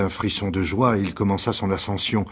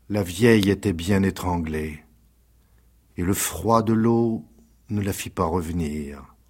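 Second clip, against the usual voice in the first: ordinary delivery, nothing marked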